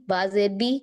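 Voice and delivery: ordinary talk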